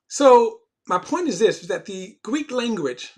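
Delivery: a sermon's key point pressed hard; emphatic preaching. Real, not acted. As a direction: preach it with force.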